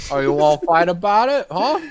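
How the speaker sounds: mock New York bruiser; New York accent. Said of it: "That's more like New York".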